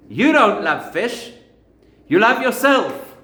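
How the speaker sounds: loud, slow, high indignant accusation; furious retort. Retold or imitated. Vegan at the next table tells off the fish lover, indignant and loud. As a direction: spit it out as an outraged rebuke. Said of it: "So the vegan is incensed, furious".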